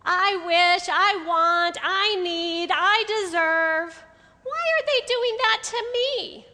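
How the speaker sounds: loud, whiny me-first demanding; selfish demands. Pretend. Speaker voicing selfish thoughts to the women, loud and demanding. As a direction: belt out every selfish want like a spoiled child. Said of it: "nobody in this room ever has any selfish thoughts".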